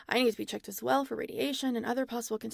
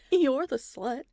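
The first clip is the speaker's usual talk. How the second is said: YOU'RE punched louder as a retort; stress on YOU'RE; 3 semitones higher, 7 dB louder, slower than usual. Acted. Scientist throwing the clone's insult back, stress on YOU'RE. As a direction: hit "You're" hard to turn it back.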